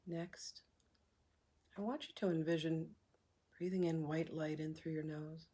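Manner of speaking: soft, unhurried meditation voice with long pauses; hushed, calm breathwork leader; guided meditation. Acted. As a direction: guide them gently through each breath, leaving room to breathe.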